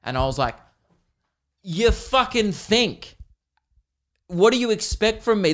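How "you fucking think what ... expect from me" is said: raised, higher-pitched mock outrage; indignant retort. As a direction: talk back to the watch, fed up. Acts out snapping back at watch.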